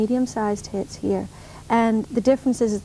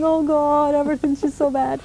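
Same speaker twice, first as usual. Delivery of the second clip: hammed-up whine of woe; mock whining; 4 semitones higher than usual. Singer imagining whining to friends on the phone, mock whining. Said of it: "whine to them".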